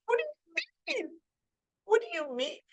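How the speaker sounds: high, soft baffled repeat; baffled lady. Retold or imitated. Lady who hit her son, baffled by his calm. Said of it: "she's thinking".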